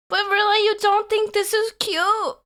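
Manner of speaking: high, sweet catgirl voice; put-on cute voice. Playing cute catgirl for chat.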